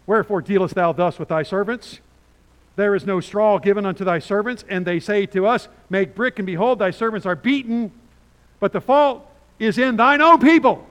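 loud, urgent scripture reading; bold protest read out. Acted. Officers protesting to Pharaoh, loud and bold. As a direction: plead boldly before a king.

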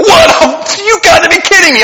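high, loud shocked disbelief; incredulous. Retold or imitated. Preacher imitating his reaction to the young man, loud shock. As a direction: blurt out stunned disbelief. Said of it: "get your kids and go".

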